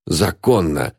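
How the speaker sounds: plain reading voice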